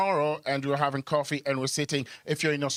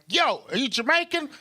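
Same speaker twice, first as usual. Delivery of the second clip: much higher, loud "Yo"; mock excited asker; 11 semitones higher, 3 dB louder than usual. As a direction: call out eagerly.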